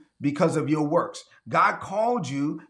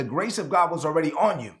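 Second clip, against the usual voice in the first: ordinary delivery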